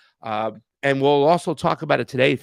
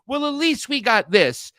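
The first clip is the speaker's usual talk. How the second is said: higher, slow, smug mock voice; mocking liberal voice; 8 semitones higher, 3 dB louder than usual. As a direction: parody the smug progressive who settles for crumbs. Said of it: "they almost sound like shit libs or progressives".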